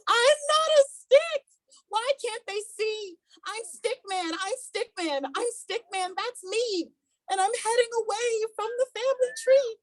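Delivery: high, pleading stick figure voice; desperate Stickman. Acted. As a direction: plead to be recognised.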